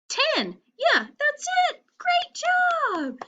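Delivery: very high, bright cheer; big praise. Real, not acted. Teacher celebrating the children's answer, very high and excited. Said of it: "praising the answer".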